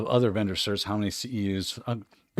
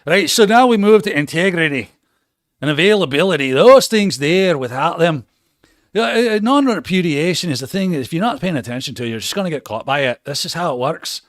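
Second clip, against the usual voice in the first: mock Scottish teacher, louder and higher; Scottish accent; 5 semitones higher, 3 dB louder than usual. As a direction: lecture in a hammed-up Scottish accent. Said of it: "Or do it Scottish".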